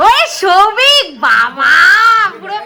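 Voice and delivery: high, drawn-out delighted greeting; real excited hello, pitched well up. Real, not acted. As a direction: gush over a long-lost visitor in a high, stretched voice. Greets her son's friend after long absence.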